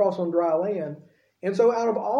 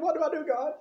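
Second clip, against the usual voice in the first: high, softer, mock whimper; sulking plea; 8 semitones higher, 4 dB quieter than usual. Moses sulking in the corner, crying to God, high mock whimper. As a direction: whine it to God like a sulky kid. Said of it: "sulking and crying to God".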